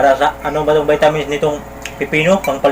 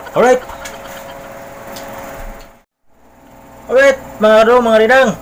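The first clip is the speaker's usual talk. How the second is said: excited; 8 semitones higher than usual. Real, not acted. Vlogger hyped intro to viewers.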